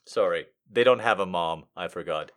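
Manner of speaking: ordinary delivery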